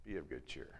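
soft, low and reassuring; quiet gentle words of the Lord. Acted. The Lord comforting him, soft and low. The Lord speaks, quoted softly.

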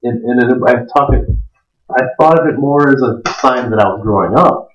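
usual speaking voice

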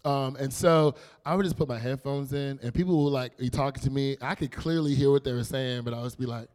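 normal talking voice

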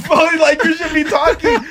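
high, loud laughing protest; loud outburst. Real, not acted. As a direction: shout it back at the teasing friend. Outburst in mutual teasing.

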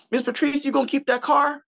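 high-pitched, hammed-up innocent asking; mock innocent. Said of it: "very innocently and go".

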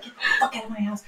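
a hint of a territorial dog; Coda's dog voice. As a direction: toss off a grumpy dog's order. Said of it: "He's like".

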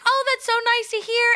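high delighted reply; high sweet imitation. Imitating host.

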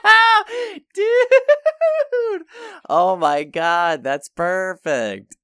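high squeal easing into slow, loud delight; ecstatic, very high and loud, gradually coming down; real thrilled outburst. Real, not acted. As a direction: shriek with laughing excitement, then draw out the words. Thrilled by the casting suggestion.

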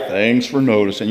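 low, soft, gloomy donkey voice; Eeyore. Acted. Preacher joking about his melancholy, as glum Eeyore. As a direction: mope it out, low and quiet. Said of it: "I tend towards Eeyore".

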